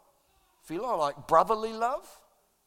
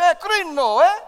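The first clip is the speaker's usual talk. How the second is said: loud, higher "Hey, krino, eh?" with a tag; jokey greeting; 7 semitones higher, 10 dB louder than usual. Shown as a demonstration. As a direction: greet a neighbour cheerfully. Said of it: "Turn to your neighbor, say".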